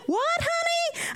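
very high, drawn-out American sing-out; exaggerated American squeal, slowed right down; mock American accent. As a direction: imitate an over-sweet American lady. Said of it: "And she was American".

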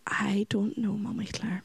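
put-on small hushed whisper of a troubled child; a child's lost whisper. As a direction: breathe it out, barely voiced. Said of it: "He said".

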